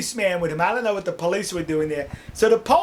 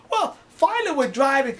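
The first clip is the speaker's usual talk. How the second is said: ordinary delivery, nothing marked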